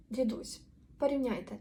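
usual speaking voice

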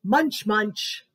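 usual speaking voice

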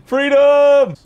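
high, loud cry of freedom; mock triumphant shout. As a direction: yell it like a movie hero. Cheering an escaping dinosaur.

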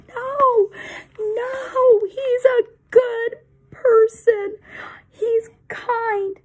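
high, loud, tearful protest; crying out. Acted. Benjamin cries out to the crowd defending Jesus. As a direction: cry out in anguish for him. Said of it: "Benjamin cried out".

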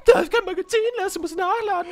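high, loud whiny mimic of his friend; mocking mimicry. Gamer mimics his friend's advice in a squeaky mocking voice. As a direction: parrot him in a squeaky mocking voice. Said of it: "Lars, du hast kein Magazin".

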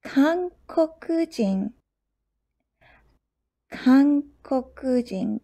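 Kankokujin drawn out syllable by syllable; slow teaching pace. Shown as a demonstration. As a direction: stretch Kankokujin slowly for learners. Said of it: "Another neighbor".

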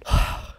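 loud, heavy sigh; exasperated sigh. Real, not acted. Gamer after yet another crash, exasperated sigh. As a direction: blow out a big breath of frustration.

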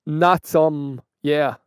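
ordinary talk, nothing marked

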